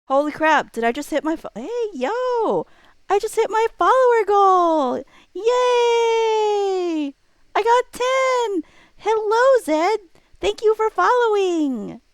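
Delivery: high, loud squealing excitement; real joy at hitting the follower goal; super excited. Real, not acted. Streamer to new follower, hitting follower goal, squealing with excitement. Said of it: "You made me super excited".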